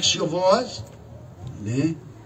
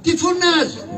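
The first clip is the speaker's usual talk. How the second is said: a sharp, much higher snap; irritated scolding; 9 semitones higher than usual. Acted. Karagiozis scolds the crier for shouting, sharply. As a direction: tell him off for yelling.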